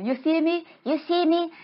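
high, taunting ghost question; high eerie questioning; ghost fish. Acted. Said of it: "he's like".

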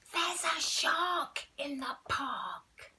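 put-on a small boy calling out his warning; child's loud cry. Boy spots a shark, calls out slowly. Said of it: "In his loudest voice".

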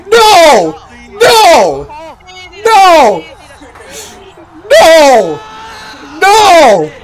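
loud, high no's; disbelieving shouts. Real, not acted. Disbelief after catch.

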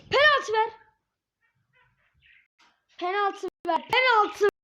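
loud repeated yell; angry demand. Real, not acted. Frustrated gamer yelling at the ref for a penalty.

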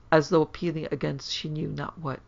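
ordinary delivery

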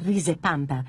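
ordinary delivery, nothing marked

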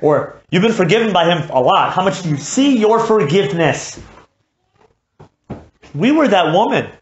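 high, loud pulpit pleading; fiery sermon climax; impassioned preaching. Acted. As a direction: press the congregation hard. Preaching at full force.

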